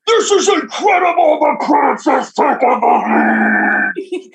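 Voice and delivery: high, loud announcer shout; sports announcer shout. Retold or imitated. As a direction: call the lead to the whole arena. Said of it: "the announcer just go".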